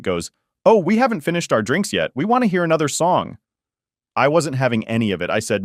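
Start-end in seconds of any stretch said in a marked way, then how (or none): 0.6-3.4 higher, lightly voiced innocent mom; mock innocent. Mom brushing off her son, higher and mock-innocent. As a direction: play the mom all innocent, pitched up. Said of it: "still trying to act all innocent".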